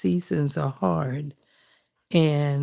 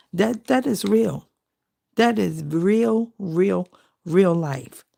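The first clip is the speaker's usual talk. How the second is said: higher and louder, preaching it; fervent emphasis; 3 semitones higher, 4 dB louder, slower than usual. Real, not acted. Preaching to viewers that struggles are real, fervent. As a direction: lean in hard on real.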